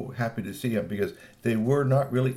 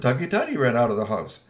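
ordinary delivery

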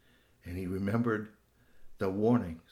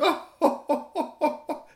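high, hooting "oh-ho-ho" laugh; fox's laugh; 6 dB louder than usual. Acted. Fox laughs at his own joke, high and hooting. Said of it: "he laughed at his own joke".

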